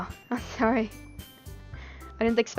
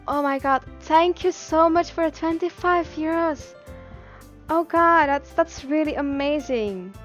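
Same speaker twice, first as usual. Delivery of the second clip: raised, louder, thrilled thanks; overwhelmed gratitude; 6 semitones higher, 5 dB louder than usual. Real, not acted. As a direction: gush in real amazement at the gift. Thanking for surprise donation.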